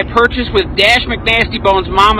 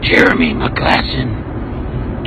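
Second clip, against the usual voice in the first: much lower, slow, hoarse whispered Batman growl; mock Batman voice; 13 semitones lower, much slower than usual. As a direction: growl the name like a brooding Batman. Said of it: "talking like this, like you're fucking Batman".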